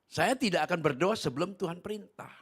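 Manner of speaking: loud, high and firm; emphatic declaration. Real, not acted. Preacher refuses to pray before God commands, emphatic declaration.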